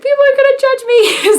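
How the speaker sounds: high, loud whiny self-mockery; mock whine. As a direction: squeak it out like a panicked kid. Mock-whining her own worry.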